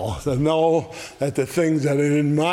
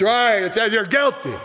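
high, raised confirmation; hollering; 7 semitones higher, 3 dB louder than usual. Acted. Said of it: "voice of the law hollering out".

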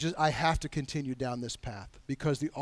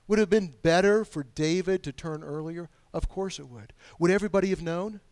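ordinary delivery

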